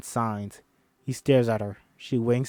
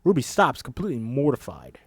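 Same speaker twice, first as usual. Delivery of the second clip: usual speaking voice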